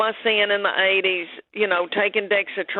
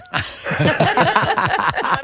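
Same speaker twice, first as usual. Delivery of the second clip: a big real burst of laughter, higher and louder; hearty laugh; 8 semitones higher, 4 dB louder than usual. Real, not acted. Guest laughing at her own probiotic joke, big hearty laugh.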